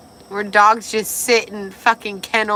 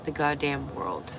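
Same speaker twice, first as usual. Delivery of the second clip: ordinary talk, nothing marked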